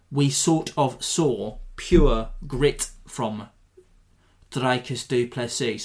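put-on a slow, light send-up of a South African accent; mock South African accent. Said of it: "Sorry, I said I wouldn't do that again".

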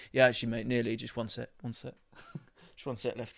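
soft, meek reply, trailing away; meek mumble. Retold or imitated. Answering the gym guy, soft meek mumble. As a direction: mumble it apologetically. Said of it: "I just go".

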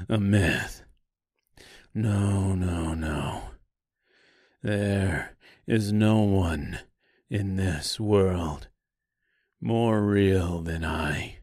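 slow, low southern drawl; reply unhurried with a light southern twang; slight southern accent. A narrator's reading. Tin Woodman answering the tree, slow slight southern accent. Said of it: "he replied with a slight southern accent".

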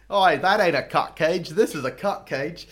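loud, slow mock Australian boast; Australian bloke. Aussie bloke one-upping the speaker, loud mock Australian bravado. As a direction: puff up like a cocky Aussie showing off. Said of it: "that's Australian right there".

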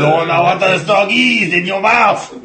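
loud high hammy French Canadian; overdone Cajun-French Gambit voice; French Canadian accent. Retold or imitated. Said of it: "doing a bad voice for Gambit".

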